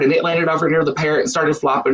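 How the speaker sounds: ordinary talk, nothing marked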